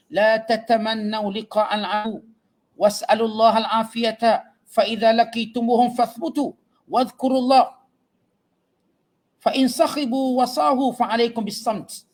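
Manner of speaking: slow, measured chant of the Arabic text; Arabic hadith recitation. Acted. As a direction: intone the hadith like a preacher. (bacaan hadis berirama perlahan)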